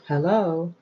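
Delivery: a touch of a shouted "Hello". Shown as a demonstration. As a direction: call out "Hello" across a room, lightly. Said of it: "To shout".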